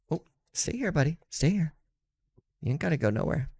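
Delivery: gently but firmly keep the pup in place; coaxing the dog. Real, not acted.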